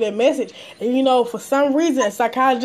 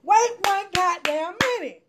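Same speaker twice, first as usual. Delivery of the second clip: higher pitched, hold-up-hold-up protest; retold pushback; 6 semitones higher, much slower than usual. Telling the guard off in the retelling, higher indignant stop. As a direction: stop him cold, mock indignant. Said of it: "I'm like".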